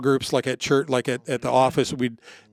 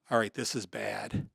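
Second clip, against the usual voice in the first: quiet inner voice; 4 semitones lower, 9 dB quieter, slower than usual. Retold or imitated. Pausing in a crisis, inner voice.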